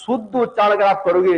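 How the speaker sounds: ordinary delivery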